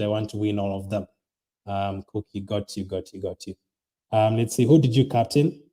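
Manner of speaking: ordinary talk, nothing marked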